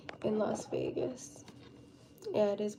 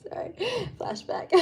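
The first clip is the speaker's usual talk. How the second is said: whispering; 11 semitones higher, 8 dB quieter than usual. Real, not acted. Laughing aside mid-story.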